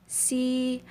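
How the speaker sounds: SI with a plain s, held high; wrong S sound. Shown as a demonstration. Teacher showing the reading to avoid, plain S. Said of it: "Not si 아버지".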